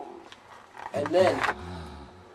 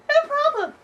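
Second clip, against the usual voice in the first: much higher, overly polite; high fake-nice voice. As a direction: sound nice but not mean it. Kelly quoted.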